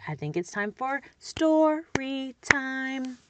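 sing-song teacher voice. Shown as a demonstration. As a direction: sing out story time like a preschool teacher. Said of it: "and I model".

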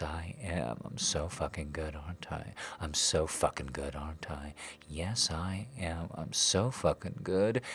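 normal talking voice

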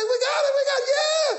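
very high, loud jubilant scream; wife's joyful shout. Retold or imitated. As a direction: scream it in delight as the wife. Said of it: "She said".

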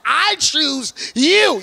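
comically slow vow of devotion; smitten declaration. Retold or imitated. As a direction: stretch out CHOOSE and YOU dramatically. Retelling love at first sight.

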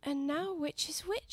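talking to herself. Acted. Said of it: "she said to herself".

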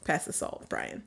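fading to near whisper; breezy dismissive parent. Pretend. Parent ignoring the adopted child's real name, dry and breezy. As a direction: brush it off and trail away. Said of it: "I'll be like".

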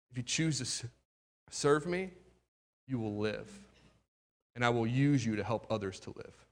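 low, soft and grave warning; a quiet inner voice foretelling doom; hushed, solemn divine warning; hushed voice of God. Retold or imitated. God's voice speaking inside him.

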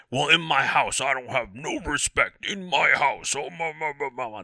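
hammed-up man of the house; put-on voice trailing off into babble; mock blustering husband. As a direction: play a joke know-it-all husband. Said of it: "That's actually a joke that we".

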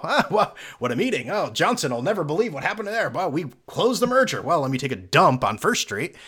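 hammed-up chummy businessman, lively pitch swings; mock businessman. Businessman chatting after closing a merger, mock businessman voice. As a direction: play a self-satisfied executive leaving a meeting. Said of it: "is this like a businessman".

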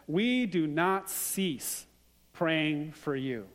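each word laid down slowly and deliberately; slow solemn quote. Retold or imitated. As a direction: read Paul's words with slow weight. Quoting Paul's letter solemnly.

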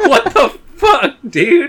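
high, slow disbelief; stunned and confused; incredulous. Retold or imitated. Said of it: "You're like".

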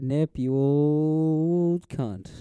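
slow drawn-out imitation of a friend's insult; lightly voiced, stretched-out friendly jab; mock quote. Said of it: "you said".

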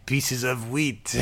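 slow mock French parrot squawk; be a dapper French parrot. Imagining a moustached French parrot, mock French parrot voice. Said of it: "parrot with a thin moustache saying".

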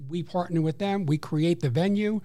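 ordinary delivery

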